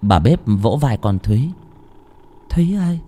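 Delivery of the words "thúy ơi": put-on hushed, stammering old cook, a touch of fear; frightened old woman. A narrator's reading. Old cook asking Thúy about a strange sound, hushed and stammering. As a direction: whisper it, halting on each word.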